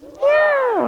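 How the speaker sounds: high, loud falling meow; scared cat meow. Pretend. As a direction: squeal it like a frightened kitten. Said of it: "And then it's a scared cat".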